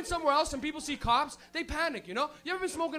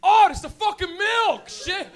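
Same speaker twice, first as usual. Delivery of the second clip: much louder and higher, then slow and stressed; loud sudden realization; 6 semitones higher, 10 dB louder, slower than usual. Retold or imitated. Stoner finding milk in the cupboard, loud surprise. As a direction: shout the discovery out in shock. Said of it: "you're like".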